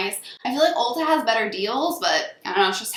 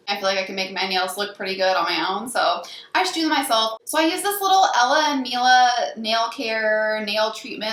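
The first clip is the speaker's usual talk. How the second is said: ordinary talk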